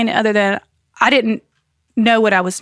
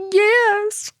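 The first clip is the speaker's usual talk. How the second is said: squeaky yes; 13 semitones higher than usual. Retold or imitated. Retold answer to husband.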